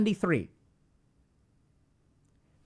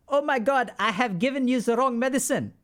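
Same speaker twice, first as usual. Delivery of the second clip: raised, alarmed mock-French voice; bad French accent; 6 semitones higher, 3 dB louder than usual. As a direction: act aghast in a poor French accent. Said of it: "I can't do a French accent".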